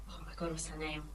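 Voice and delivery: ordinary delivery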